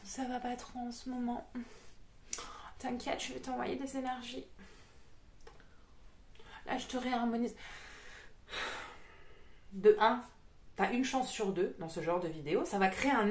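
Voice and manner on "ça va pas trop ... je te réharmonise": soft, hushed parody of online healing; mock-soothing healer voice; mock energy healer. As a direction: speak softly, spacing out the phrases. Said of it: "Et là tu fais".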